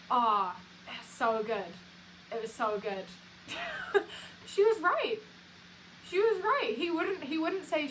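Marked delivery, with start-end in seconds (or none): 3.5-4.4 high squealing laugh; real squeal of delight, much higher. Real, not acted. Overjoyed retelling of the moment. 4.5-7.9 higher and louder, triumphant; real giddy delight, higher and louder; gleeful. Real, not acted. Overjoyed retelling of the moment.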